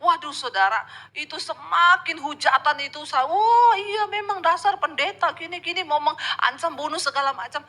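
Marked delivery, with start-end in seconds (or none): 3.3-7.6 high, loud scornful jeer, fading off; mocking sneer. Preacher voicing a hater mocking pastors, high scornful sneer.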